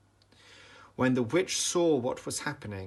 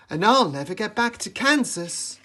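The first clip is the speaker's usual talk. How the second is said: crying out; 10 semitones higher, 9 dB louder than usual. A narrator's reading. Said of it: "cried Dorothy".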